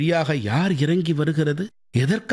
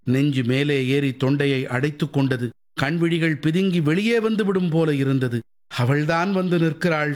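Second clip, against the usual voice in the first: ordinary delivery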